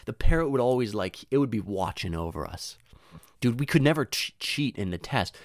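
usual speaking voice

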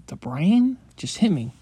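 ordinary delivery

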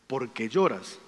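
low, soft fatherly question; gentle questioning. Retold or imitated. Father to his crying five-year-old son, gentle questioning.

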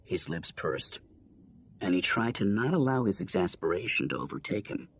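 ordinary delivery

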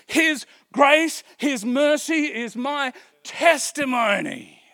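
high, loud, rising exhortation; fervent rallying preacher; preaching at full force. Acted. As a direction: lift them up with it.

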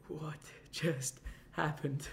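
slow, soft disbelieving laugh through it; half-laughing, half-dazed; driving off, a slow soft laugh of disbelief; stunned laugh. Retold or imitated. After speeding away.

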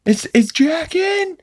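high, slow put-on Peter voice, asking politely; imitating Peter on phone. Dad on the phone asking for his friend Jack, high and slow. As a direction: squeak out a timid phone query for Jack. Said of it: "he's like".

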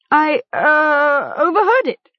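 high-pitched, faltering fib; hesitant excuse. A narrator's reading. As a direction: stall on "uh", then get it out. Gom invents an excuse, hesitating.

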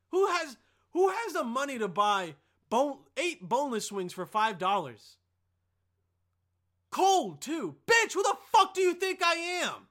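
shouting high and loud over the overpriced wings; angry rant. Real, not acted. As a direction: yell it out, outraged at the price. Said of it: "Sorry for getting angry like that".

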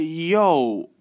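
high, slow, amazed call-out; a fan spotting a star, pitch way up; hyped yo are you him; excited recognition. Retold or imitated. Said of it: "I'm looking, and I'm like".

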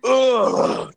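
drawn-out groan of dismay; exasperated groan. Real, not acted. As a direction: groan aloud at being reminded. Groans at birthday reminder.

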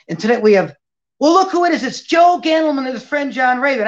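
bright, high announcer build-up; host introduction. Retold or imitated. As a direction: hype him up like a big celebrity. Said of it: "she says".

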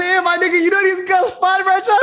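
excited buddy call. Retold or imitated. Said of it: "I said".

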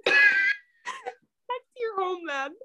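high, slow, breaking with laughter; laughing through words. Real, not acted. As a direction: squeal it out while laughing.

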